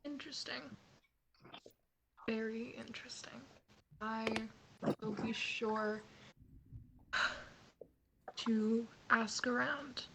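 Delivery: hushed. Acted. Player in character promising to ask around, hushed. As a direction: let it out quietly and deliberately, pausing between phrases.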